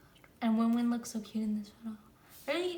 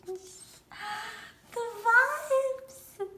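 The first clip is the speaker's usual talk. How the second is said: excited squeal; 9 semitones higher, 5 dB louder than usual. Real, not acted. Fangirl reacting to photo.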